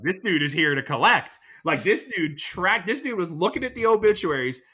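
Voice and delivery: alarmed realization. Retold or imitated. Realizing the bookie came to collect.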